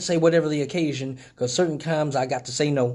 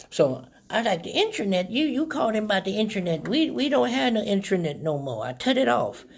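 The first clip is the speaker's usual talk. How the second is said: a higher voice, playing a dotty old lady; confused old lady; 6 semitones higher than usual. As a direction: pick up the phone like a muddled grandma. Pranking the telemarketer as old lady.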